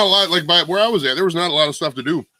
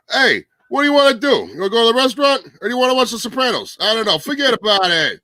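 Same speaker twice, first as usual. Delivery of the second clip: high, loud hammed-up Brooklyn wiseguy voice; mock a Brooklyn local shrugging off plans in a broad Brooklyn accent; exaggerated Brooklyn accent, laughing at the end; 8 semitones higher, 4 dB louder than usual. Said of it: "here's my impression of, uh, people talking about stuff to do in Brooklyn".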